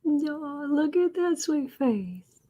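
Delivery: slow tender pet talk; cooing. Real, not acted. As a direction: linger over the cat's sweet face. Cooing at cat.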